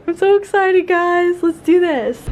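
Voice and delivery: high, bright excited squeal. Real, not acted. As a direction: gush with giddy excitement.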